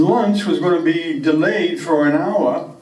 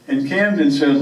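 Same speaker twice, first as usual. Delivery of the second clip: normal talking voice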